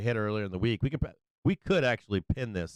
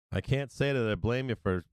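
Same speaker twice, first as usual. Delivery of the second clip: normal talking voice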